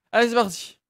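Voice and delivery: excited shout. Real, not acted. Heist starts.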